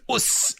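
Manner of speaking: high, soft "us" meaning nailed it; Aussie "us". Retold or imitated. Explaining Bandit's catchphrase, high soft "us". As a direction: clip "us" short and proud. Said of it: "you just do a little bit of a".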